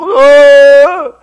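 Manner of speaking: loud, high, drawn-out frightened cry; hammed-up wail of fright; imitated frozen, wordless yell; mock terrified groan. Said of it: "막 무서워".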